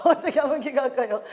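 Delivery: put-on giggling through the child's question; laughing while quoting. Grandmother laughing as she repeats her grandson's question.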